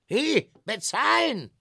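high, loud call after him; salesman calling out. Acted. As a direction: shout after the fleeing dragon. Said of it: "rief der Verkäufer".

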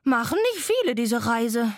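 loud, huffing train voice; puffing locomotive. A narrator's reading. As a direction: puff it out like an old engine. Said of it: "schnaufte die Lok". (schnaufende Lok)

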